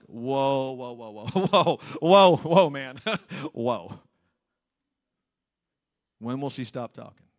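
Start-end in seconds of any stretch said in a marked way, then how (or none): 0.1-4.0 a hint of giggling awe; stuck on whoa, laughing; stammering whoas. Adam staring at Eve, giggly stammered whoas. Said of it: "Or maybe he was like".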